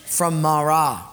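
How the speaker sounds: ordinary talk, nothing marked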